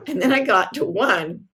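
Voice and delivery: ordinary delivery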